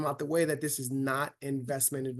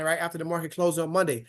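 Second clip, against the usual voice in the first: ordinary talk, nothing marked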